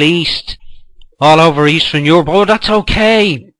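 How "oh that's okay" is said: drawn-out, higher, louder mock acceptance; sarcastic. Mocking their acceptance of immigrants, sarcastic. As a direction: pretend to shrug it off, dripping sarcasm.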